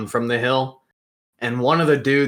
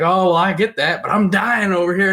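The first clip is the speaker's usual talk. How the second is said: louder, higher mock pleading; imitated desperate complaint; 7 semitones higher, 5 dB louder than usual. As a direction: plead your case as the parched man, retold. Thirsty man complaining, acted.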